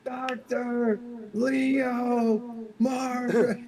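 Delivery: very high, slow drawn-out name; mock movie voice. Cohost quoting What About Bob, drawn-out high voice. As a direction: stretch the name out in a silly high voice.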